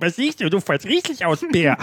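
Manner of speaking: high squeaky bee voice, half laughing; high-pitched, giggly insect impression; cartoon bee. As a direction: ask it as a tiny cartoon bee. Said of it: "Da kam eine Biene geflogen und fragte".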